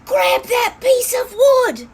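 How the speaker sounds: slow, loud, high shout across the river; called out. Acted. Scamp calling to drowning Hopper, a slow, loud, high shout. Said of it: "called Scamp".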